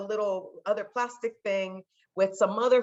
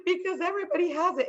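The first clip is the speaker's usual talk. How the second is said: put-on high, quick childish plea; pleading kid; 12 semitones higher, faster than usual. Daughter arguing with dad, high childish plea. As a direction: blurt it like a kid. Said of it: "And I'm like".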